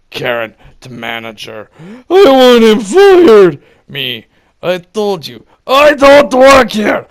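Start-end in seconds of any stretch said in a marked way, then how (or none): 2.1-3.6 high, loud, shrill demand; mock entitled shriek; Karen demanding. Karen to manager. 4.6-7.1 higher, louder angry retort; loud fed-up shout; exasperated yell. Retold or imitated. Yelling at Karen.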